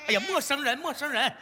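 put-on high, repetitive hushing of a baby; flustered baby-soothing. Flustered man hushing a crying baby.

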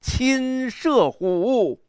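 极慢拉长，“亲射虎”高扬; wild, proud declamation, very drawn out. Shown as a demonstration. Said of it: "你看这个狂". (狂傲豪迈地拖腔朗诵)

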